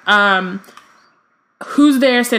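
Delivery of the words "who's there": calling out. Acted. Harry calls into the chamber.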